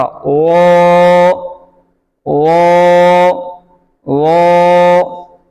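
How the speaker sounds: वो full and rounded without any whistle; steady recitation drill, वो held and heavy; thick, no whistle. Shown as a demonstration. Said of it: "वो में सीटी नहीं मोटा". (वो मोटा, बिना सीटी)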